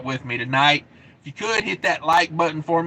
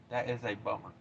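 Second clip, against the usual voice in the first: echoed under his breath, much softer; quiet mutter; 6 semitones lower, 15 dB quieter than usual. Real, not acted. Trucker muttering to himself about the closed road, very soft. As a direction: repeat it to yourself, almost inaudible.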